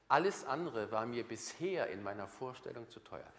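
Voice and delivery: ordinary delivery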